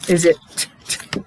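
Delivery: ordinary delivery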